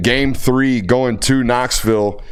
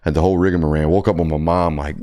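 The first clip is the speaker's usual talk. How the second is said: normal talking voice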